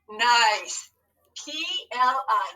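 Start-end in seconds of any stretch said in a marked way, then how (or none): none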